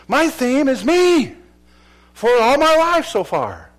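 high, loud, slow admission; confession cry. Acted. Preacher voicing a sinner's confession, loud. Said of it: "The first step is saying".

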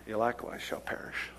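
whispered scripture. Acted. Preacher quoting Jesus to the church, whispered. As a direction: let the warning hush.

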